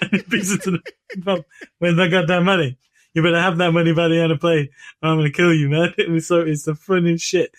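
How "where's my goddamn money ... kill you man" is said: louder, fast mock threat; angry Stewie. Stewie threatens Brian over the money, loud and fast. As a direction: rattle off a hammed-up cartoon threat.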